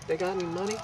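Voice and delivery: high, hushed imitation of a street local; sly mimicked thug. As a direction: ask it on the sly in someone else's voice. Imitating thug's sly question.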